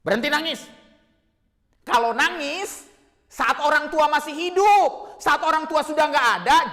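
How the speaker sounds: loud, high, full-force telling-off; angry scolding. Acted. Preacher rebuking weeping children of the dead, furious shout. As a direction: scold them hard, drawing out key words. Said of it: "Marah besar saya".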